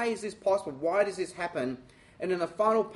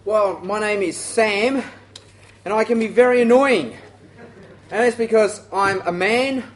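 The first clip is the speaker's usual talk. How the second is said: loud opening; 2 semitones higher, 9 dB louder than usual. Acted.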